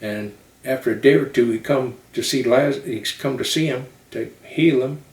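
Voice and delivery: put-on Southern-accented sermon storytelling with punched phrases; Southern preacher. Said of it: "but they talk like this".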